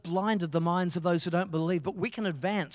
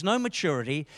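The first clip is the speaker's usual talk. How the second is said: usual speaking voice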